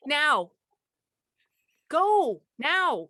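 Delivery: loud, high urgent yell. Real, not acted. Clue-giver to guessing partner in a timed game, yelling. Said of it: "He just kept yelling".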